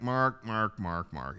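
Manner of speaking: put-on low, trailing half-drunk repetition; half-drunk man. Half-drunk man answering talk of God's love, lightly imitated. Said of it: "he was kinda half drunk".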